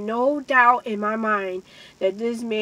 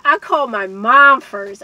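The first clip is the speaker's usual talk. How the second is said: loud emphatic; 9 dB louder than usual. Real, not acted. Emphatic repeat.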